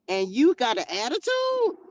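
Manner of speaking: sharply questioning, ATTITUDE swooping upward; mock incredulity. Preacher scolding Cain for sassing the Lord, mock incredulity. Incredulous at Cain's back talk.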